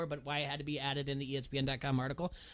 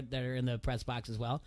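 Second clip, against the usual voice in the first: ordinary talk, nothing marked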